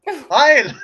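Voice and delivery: loud, punched mock salute; mock greeting. Host imagining cohost greeting relatives, loud mock greeting. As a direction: call it out as a joke. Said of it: "go over dressed up as Elsa and be like".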